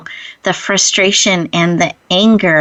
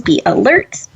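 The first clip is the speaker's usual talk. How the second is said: usual speaking voice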